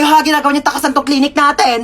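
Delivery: normal talking voice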